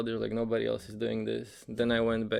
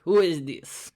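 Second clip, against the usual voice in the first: mock Russian accent, higher and louder; 6 semitones higher, 7 dB louder, slower than usual. Guard asking the repeat ringer who he is, in a mock Russian accent. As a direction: bark the question like a suspicious Russian guard. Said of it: "it's this Russian accent".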